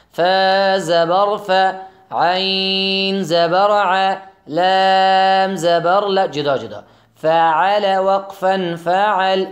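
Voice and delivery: slow, loud, syllable by syllable; slow spelling drill. Shown as a demonstration. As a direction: spell each letter out slowly, like a Quran teacher. (تلفظ آهسته و بلند حرف به حرف)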